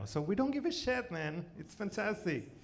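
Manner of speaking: ordinary talk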